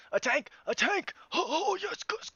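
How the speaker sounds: high, loud cry of delight; excited. Real, not acted. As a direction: shout it out, overjoyed. Finally finding a tank.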